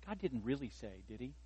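a hushed, sly hint of the serpent; a soft, sly mock-tempter aside; tempting whisper. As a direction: lightly play Satan coaxing Eve. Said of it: "he's whispering to Eve".

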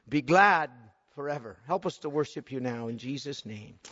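hushed pastoral prayer; closing prayer. Acted. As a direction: pray gently for the congregation.

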